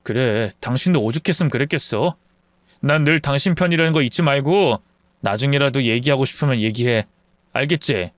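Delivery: low, gentle reassurance; reassuring husband. Acted. Husband telling his wife he's on her side, reassuring.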